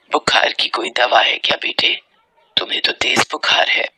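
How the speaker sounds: low, breathy motherly voice; hushed and low, over a sick child; gently worried mother, barely voiced; concerned mother. Worried mother tends sick son.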